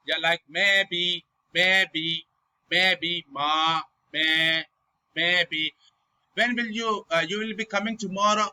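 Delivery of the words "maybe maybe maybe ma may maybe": "maybe" drawn out on a level pitch; "maybe" with no rise or fall; flat tone. Shown as a demonstration. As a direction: keep "maybe" and "ma" flat and held. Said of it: "keep your voice flat like".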